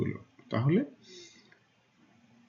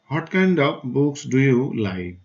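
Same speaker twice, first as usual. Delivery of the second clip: "kind" given the main push in a slow question; stressed question read slowly; much slower than usual. Shown as a demonstration. Teacher models the question for students, stress on "kind". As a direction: ask it slowly, leaning on "kind". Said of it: "a little loud অর্থ হলো একটু জোর দিয়ে".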